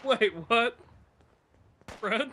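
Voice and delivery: high, loud, rising double-take; baffled surprise. Real, not acted. Gamer confronting a dead character who is alive, baffled. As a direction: genuine bewildered questioning.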